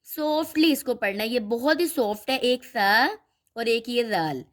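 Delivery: ordinary delivery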